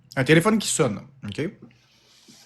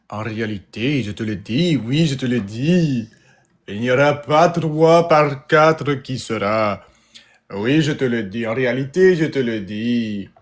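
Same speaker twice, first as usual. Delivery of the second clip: lower-pitched mock Russian accent; 4 semitones lower than usual. Shown as a demonstration. Showing the audience a Russian accent, mock Russian delivery. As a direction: put on a Russian accent in French. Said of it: "Parler avec l'accent russe?".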